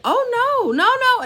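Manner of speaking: high, loud startled protest; shocked, stop him fast; alarmed no. Retold or imitated. Mom startled by her son wanting to kill the hackers, high and loud. Mom reacting to son's threat.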